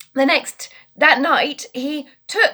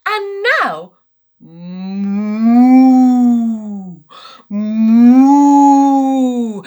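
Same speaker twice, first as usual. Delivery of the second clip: cow moo; 7 dB louder, much slower than usual. Retold or imitated. Cow noise in story.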